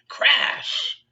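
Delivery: breathy loud crash effect, very high; crash noise. Acted. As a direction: spit out the crash sound. Trash can falls.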